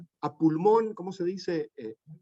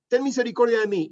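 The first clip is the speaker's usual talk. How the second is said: higher, pleading for mercy; pleading cry; 7 semitones higher, 4 dB louder than usual. Acted. Blind beggar pleading to Jesus over the crowd. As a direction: beg for mercy, calling out. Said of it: "pero él clamaba mucho más".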